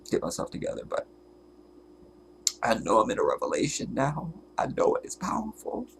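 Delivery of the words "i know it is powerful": tearful. Real, not acted. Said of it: "I got the snot and everything now".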